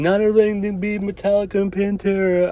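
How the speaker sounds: mimicking the wife. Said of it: "My wife's like".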